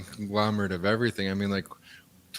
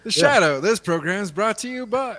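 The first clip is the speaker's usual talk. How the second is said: radio announcer; 9 semitones higher than usual. Pretend. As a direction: sell it like a 1930s radio ad. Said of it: "If they did that again".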